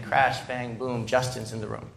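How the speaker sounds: low, soft repeat of a worn-out rhyme; jingle muttered in recap. Retold or imitated. Son recalling his parents' endless refrain, low and subdued. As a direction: let the jingle drop away, low and quiet. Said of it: "Over and over again".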